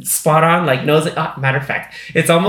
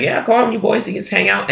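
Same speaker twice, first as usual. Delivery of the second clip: a light, higher mock Queen Mother; 7 semitones higher than usual. Retelling the Queen Mother waving the men on, lightly higher. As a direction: pitch it up like a breezy queen inviting the boys in. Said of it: "She's like".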